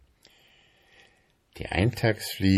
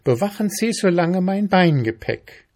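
high, louder, bossy ant; energetic ant; 11 semitones higher, 7 dB louder than usual. A narrator's reading. Ant ordering beetle to guard luggage, energetic. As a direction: give orders energetically, pitched up. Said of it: "sagte die Ameise energisch".

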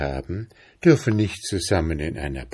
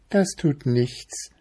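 higher little mushroom, untroubled; unruffled squeaky voice; 6 semitones higher than usual. A narrator's reading. Chanterelle brushing off the bear's threat, calm high voice. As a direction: shrug it off in a small high voice. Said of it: "mit einem so piepsigen Stimmchen".

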